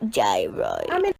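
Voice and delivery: very deep, rising. Acted. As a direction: drop to a deep bass. Extremely deep voice.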